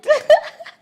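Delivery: a high, rising laugh; pleased laugh as husband makes food. Real, not acted.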